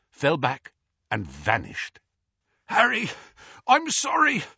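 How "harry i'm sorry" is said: high, raised-voice moan of apology; distressed, pitched-up lament. A narrator's reading. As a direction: wail the apology as Hagrid. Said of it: "moaned Hagrid".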